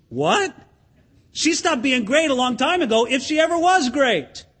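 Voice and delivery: ordinary talk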